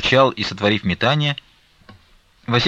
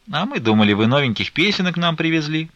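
mildly disappointed complaint, rising higher; displeased; 8 semitones higher than usual. A narrator's reading. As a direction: complain with a touch of a pout. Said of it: "недовольным голосом".

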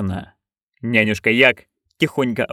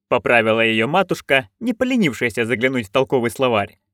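ordinary delivery, nothing marked

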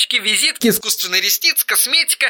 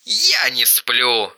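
flat, deliberate brother; a low, slowed-down crazy brother; low slow Eric voice; 8 semitones lower, much slower than usual. Acted. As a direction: drop low and drag it out.

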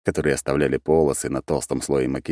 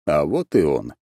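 plain reading voice

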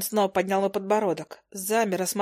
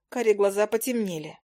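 plain reading voice

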